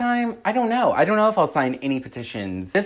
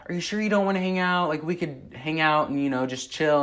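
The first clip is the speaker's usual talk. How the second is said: lightly imitated pushy visitor, pitched up; petition guy; 4 semitones higher than usual. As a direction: mimic him coaxing, starting high and easing down. Imitating the petition man's invitation.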